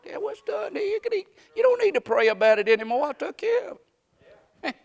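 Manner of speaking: voicing God. Preacher voicing God's reply to his wife, lightly voiced. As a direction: let God answer, easy and settled.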